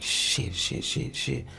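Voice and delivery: breathless, hushed repeated curse; panicked muttered curse. Retold or imitated. Man retelling the shooting, hushed panicked cursing. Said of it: "I was like".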